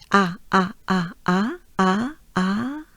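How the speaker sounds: isolated open French "a" sounds, repeated; pure open a vowel. Shown as a demonstration. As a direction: drill the French A alone. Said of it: "it's the French A".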